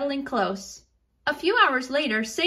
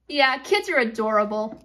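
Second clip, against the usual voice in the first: ordinary delivery, nothing marked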